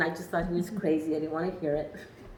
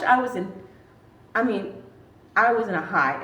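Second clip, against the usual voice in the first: usual speaking voice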